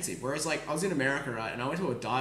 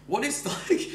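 laughing disbelief; 9 semitones higher than usual. Retold or imitated.

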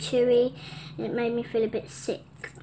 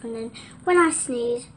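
ordinary talk

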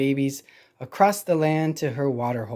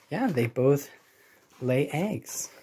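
ordinary delivery, nothing marked

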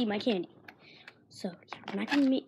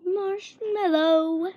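drawn-out; 6 semitones higher, much slower than usual. Real, not acted. Naming candy, drawn out.

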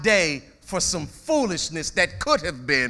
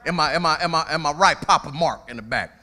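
ordinary delivery

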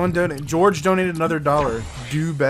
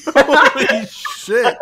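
very slow, higher, louder; slow drawn-out exclaim; 9 semitones higher, 7 dB louder, much slower than usual. Acted. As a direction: stretch it out in shock. Exaggerated reaction to teammate.

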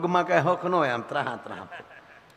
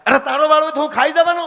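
higher, louder and fast angry retort; husband bickering with his wife; 7 semitones higher, 7 dB louder, faster than usual. Retold or imitated. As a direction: snap back indignantly.

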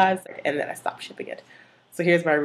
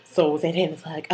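ordinary delivery

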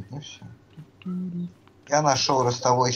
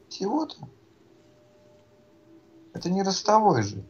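high, surprised disbelief; 8 semitones higher than usual. Real, not acted. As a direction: voice jumps up in surprise. Surprised at small shield.

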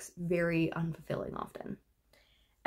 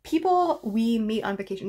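ordinary talk

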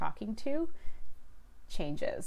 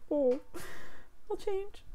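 small, high, soft voice; hammed-up sheepish surrender, high and soft; meek mock reply; 12 semitones higher, 4 dB quieter than usual. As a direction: give in meekly in a tiny high-pitched voice. Meekly giving in to mockery.

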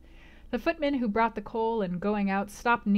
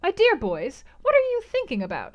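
high, louder scandalised mother; shocked exclaim; 9 semitones higher, 6 dB louder than usual. A narrator's reading. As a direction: gasp at the boys' folly. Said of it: "exclaimed Missus Lynn".

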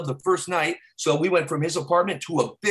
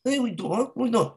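higher-pitched mock wiseguy; mock Italian mobster denying everything; mobster impression; 5 semitones higher than usual. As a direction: protest like a cartoon mafia guy. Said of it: "they're so, uh, predictable sometimes".

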